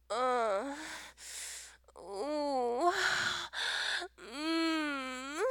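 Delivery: soft, spaced-out moaning; breathy put-on moans with long gaps; demonstrated moans. Shown as a demonstration. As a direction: sigh and hum, teasingly. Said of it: "How many moans does it take".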